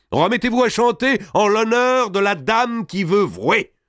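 very high, ringing command; calling out. Acted. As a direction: call the singers to perform for the whole hall. Robert calls on girls to sing.

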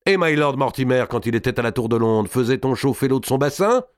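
high, pointed sarcastic question; taunting barber. A narrator's reading. (barbier narquois, voix haute)

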